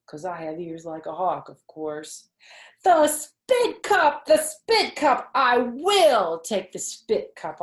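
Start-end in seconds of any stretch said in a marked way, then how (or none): 2.8-7.6 high, loud, slow excited holler. A narrator's reading. Junie B hollers across the table, thrilled by the idea. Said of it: "I hollered".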